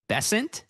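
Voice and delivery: Besant said as a puzzled question, pæ-SENT, rising; trying out Besant doubtfully. Shown as a demonstration. Streamer echoing chat's pronunciation, questioning. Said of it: "Are you tricking me?".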